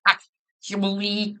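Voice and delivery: slow, nasal-sounding know-it-all "actually"; nerd voice. Retold or imitated. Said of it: "sounds like the nerd emoji".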